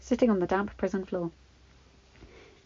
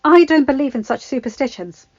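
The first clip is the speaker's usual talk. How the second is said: ordinary delivery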